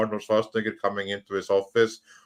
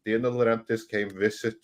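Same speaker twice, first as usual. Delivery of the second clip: ordinary delivery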